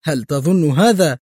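lightly mocking question; a touch of scorn; sarcastic. A narrator's reading. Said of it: "قال أدهم ساخرا".

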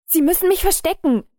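viel höher und lauter, panisch; in Todesangst betteln; panisches Flehen. A narrator's reading. Verängstigter Mann fleht um Hilfe. (panisch flehend, hoch und laut)

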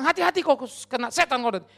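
mock ranting. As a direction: warn loudly, as if raving. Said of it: "Padahal dia kesetanan ngamuk-ngamuk".

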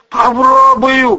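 usual speaking voice

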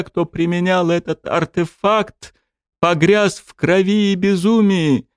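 high, solemn royal warning; stern warning king. Acted. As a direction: warn him gravely as a king. The king speaks.

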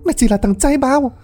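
high, screeching abuse; betrayed woman screaming insults; furious cursing. Acted. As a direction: curse him from the floor. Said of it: "chửi".

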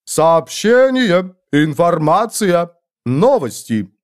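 церемониальная фраза. A narrator's reading. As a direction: proclaim each word ceremonially. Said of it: "ответил церемониальной фразой Ааро". (церемониально провозгласить, растягивая слова)

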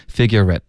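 "figurette" linked, "at" shrunk to a schwa; weakened "at". Shown as a demonstration. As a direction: say "figurette" with an unreleased t. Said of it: "这个梅花a我们弱读为一个呃了".

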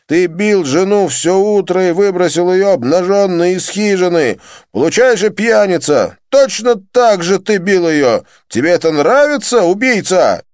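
high, loud raging taunt; furious shout. A narrator's reading. Jitendra taunts Joseph while beating him, raging. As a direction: rage at him blow by blow.

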